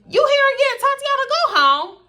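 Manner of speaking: high, loud hollering mama voice; exaggerated play-scolding, very high; mock yelling. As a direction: shoo them home at full pitch. Friend's mama playfully chasing visitor home.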